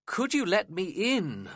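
pleading, slow and high; a cold guest begs the servant for shelter; desperate plea. A narrator's reading. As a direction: beg to be let in out of the cold. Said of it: "I asked desperately".

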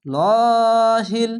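লহিল stretched long with thick lam; slow heavy-lam লহিল. Shown as a demonstration. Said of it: "মোটা করে পড়ছি".

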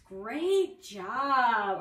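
usual speaking voice

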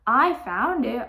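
loud, slow, insistent claim; arguing. Acted. Arthur claims the treasure from friend, arguing. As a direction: stretch it out in protest. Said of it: "argued Arthur".

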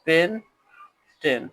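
Pentane drawn out very slowly; slow demo. Shown as a demonstration. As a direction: stretch pentane for students. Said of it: "এটা উচ্চারণ হবে". (ধীরে টেনে pentane)

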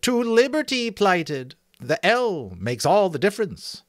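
high, loud thin voice; thin correcting voice. Acted. Sentry explaining the wrong word, thin high voice. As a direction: pipe up high to put him right. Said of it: "the thin voice corrected".

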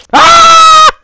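sudden loud high yell; real shout. Real, not acted. A player yells at a sudden fail. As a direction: yell out as the run fails.

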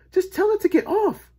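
high, loud instruction; insistent boy. A narrator's reading. Boy instructs lady urgently.

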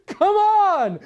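loud, high, eager shout of welcome; eager welcoming cry. Acted. Believer greeting the returning Christ with an eager, loud cry. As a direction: cry out with joy at the sight. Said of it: "And your response to his coming is".

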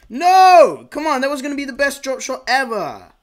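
real gamer rage; frustrated yell. Real, not acted. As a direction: shout at the miss. Missed shot in game.

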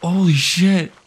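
loud startled exclaim; shocked shout. Real, not acted. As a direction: blurt out in shock. Shock at monster.

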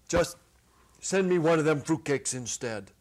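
loud, kindly big-man voice; softened giant. Acted. Ha Ha handing back the coins to the kids, loud but kindly. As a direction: offer it with a gruff softness. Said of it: "his face softens".

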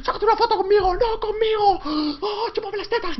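shrill, very high mock fan screams; screaming fans. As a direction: squeal like a crowd of frantic fans. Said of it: "la gente gritándome ahí en los oídos".

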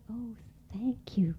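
slow whispered thanks; grateful whisper. Acted. Jumping Mouse thanking Frog, slow grateful whisper. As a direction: breathe your thanks in awe.

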